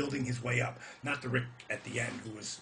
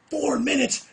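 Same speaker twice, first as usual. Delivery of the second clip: screamed, much higher and louder; outraged shout; 13 semitones higher, 10 dB louder than usual. Retold or imitated. Yelling at the gate man about four minutes, outraged shout. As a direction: blow up in disbelief. Furious at the cut time.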